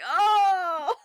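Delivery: loud, high mock sob; retold crying wail. Viewer recalling a death scene, retold crying. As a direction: burst into an exaggerated, high wail.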